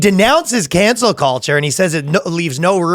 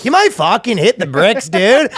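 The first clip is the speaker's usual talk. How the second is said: higher-pitched hammed-up Canadian; Canadian accent; 6 semitones higher than usual. Canadian translator putting an official's warning into slang, Canadian accent. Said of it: "They have a Canadian translator".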